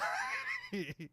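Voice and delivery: squealing laugh high up. Real, not acted. Laughs after gleeful quote.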